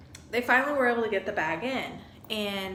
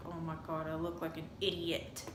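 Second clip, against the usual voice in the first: embarrassed mutter; 4 semitones lower, 9 dB quieter than usual. Retold or imitated. Embarrassed thought in checkout line.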